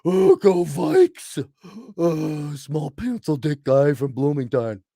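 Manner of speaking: loud, slow, dopey Vikings fan; mock dopey football fan cheering slowly; mocking sports fan. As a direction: sneer as a middle-aged Minnesota fan. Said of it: "You still got people forty plus".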